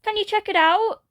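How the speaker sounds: put-on high anxious child, out of breath; breathless fox kid. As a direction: rush it out, panting and scared.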